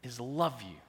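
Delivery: loud, slow swell then hushed; voicing Jesus' love. Preacher voicing Jesus to the congregation, loud then tender. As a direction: proclaim it big, then soften to a promise. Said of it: "Jesus comes and he says to you".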